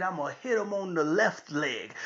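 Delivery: soft, contained setup; understated announcement. Retold or imitated. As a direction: say it quietly, holding back. Father's calm setup, against daughter's reply.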